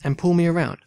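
reads the opening lines aloud with lifted energy; story narration. A narrator's reading. Player narrating the game's story to viewers, animated storytelling. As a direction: tell it like an adventure novel.

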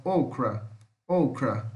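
"okra" with a long English "oh" first; careful teacher's model of "okra"; English pronunciation demo. Shown as a demonstration. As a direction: stress the opening "oh" of "okra", falling after. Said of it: "O O em inglês é O".

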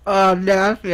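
surprised disgust. Real, not acted. Just spat rice out.

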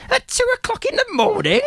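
very high, squeaky, laughing disbelief; incredulous mum. Retold or imitated. Mum woken at night by her son, very high and laughing. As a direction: shriek it out in mock outrage. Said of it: "And she goes".

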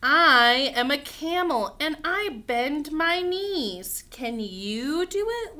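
slow, loud picture-book read-aloud; reading to children. Acted. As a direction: stretch each word out boldly for little ones.

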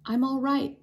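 ordinary delivery

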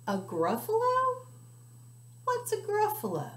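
very high, puzzled hoot; baffled by the word; startled owl. Acted. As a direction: owl squawking in surprise. Owl surprised.

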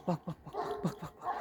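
very soft whispered repetition; whispering. Real, not acted. Owner watching the dogs meet, whispering. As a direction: whisper it rapidly under your breath.

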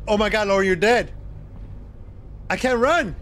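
ordinary talk, nothing marked

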